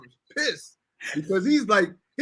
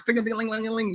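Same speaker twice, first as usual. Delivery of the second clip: tinkling rattle noise made by voice; imitated falling debris; 3 semitones higher, 3 dB quieter than usual. Storyteller imitating a broken cab door, rattling vocal noise. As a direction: imitate bits rattling loose in a slammed car door. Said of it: "you heard this".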